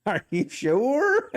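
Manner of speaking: high, slow, doubtful quoting; lightly voiced My Cousin Vinny line, high and slow; movie quote. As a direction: drawl the movie line slowly and skeptically. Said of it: "like in the movie My Cousin Vinny".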